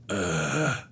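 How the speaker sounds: low, soft grunt; Geralt of Rivia. Retold or imitated. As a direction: imitate a brooding hero's wordless grumble. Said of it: "that's what he does all the time".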